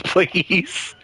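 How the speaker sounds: usual speaking voice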